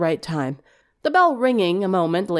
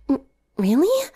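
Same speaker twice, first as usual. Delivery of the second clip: shy surprise; 7 semitones higher than usual. Acted. Twilight stunned by compliment.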